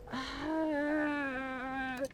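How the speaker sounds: long, soft, strained sigh; drawn-out despairing sigh; exasperated sigh. Real, not acted. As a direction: let all the air out in disbelief.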